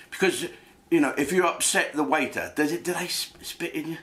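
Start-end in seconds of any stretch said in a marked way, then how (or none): none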